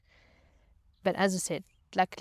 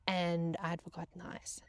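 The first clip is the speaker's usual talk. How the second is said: whispered, barely voiced admission; hushed confession; 10 dB quieter than usual. Real, not acted. Yacht crew confessing she forgot the ice, whispered. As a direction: whisper it like a guilty secret.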